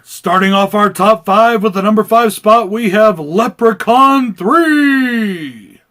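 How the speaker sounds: ring announcer. Acted. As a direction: hype it like a boxing announcer. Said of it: "Is this voice getting annoying yet?".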